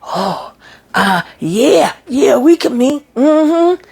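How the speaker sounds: high, eager yeah-we-can-meet; giddy and eager. Retold or imitated. Gary eagerly agreeing to meet his ex, high and giddy. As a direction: gush like a smitten man.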